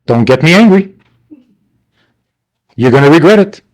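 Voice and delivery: mock parental threat, rising at the end; threatening parent. Parent warning their kid not to cause anger, mock threat. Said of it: "parents say to their kid".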